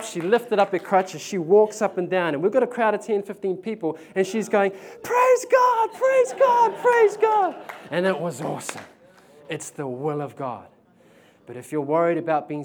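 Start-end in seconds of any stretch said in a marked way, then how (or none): 5.0-7.5 very high loud praise shouts; rejoicing woman. Retold or imitated. Preacher voicing the healed woman shouting praise. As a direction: imitate her ecstatic cries.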